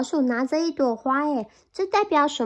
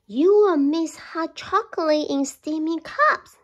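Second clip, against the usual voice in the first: higher, louder little mouse voice; eager mouse; 5 semitones higher, 5 dB louder than usual. Acted. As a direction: tempt the bear. Mouse tempting bear.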